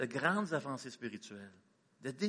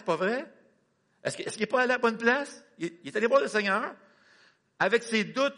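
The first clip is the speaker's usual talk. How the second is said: higher and louder, driving; fervent preaching; 6 semitones higher, 5 dB louder than usual. Acted. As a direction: preach it with fire. Preaching at full force.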